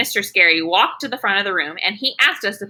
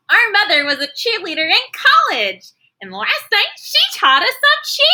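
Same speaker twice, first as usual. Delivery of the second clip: high, excited calling out; eager twins; 13 semitones higher, 3 dB louder than usual. Acted. Twins call out to Mister Scary, high and excited. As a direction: squeal it like an eager little girl. Twins bragging about cheers.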